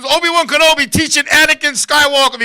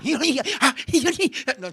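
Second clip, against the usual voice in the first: ordinary talk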